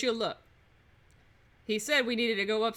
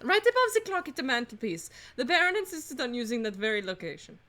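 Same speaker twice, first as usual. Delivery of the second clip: put-on French accent. Housemaid pointing out the mantelpiece clock, French accent. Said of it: "doing a French accent".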